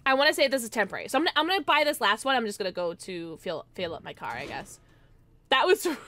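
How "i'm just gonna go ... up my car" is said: ordinary delivery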